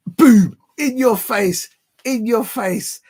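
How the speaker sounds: loud, high triumphant taunt; gloating triumph. Real, not acted. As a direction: crow it loudly at the doubters. Gloating at chat over being right.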